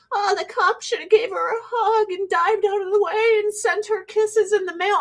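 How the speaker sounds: high, whiny mockery; high-pitched mocking whine. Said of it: "today's like".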